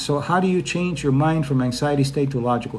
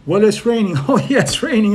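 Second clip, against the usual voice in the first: loud, higher small talk with a forced polite laugh; fake social laughter; 8 semitones higher, 7 dB louder than usual. Shown as a demonstration. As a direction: laugh nervously at nothing funny. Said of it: "That's social laughter".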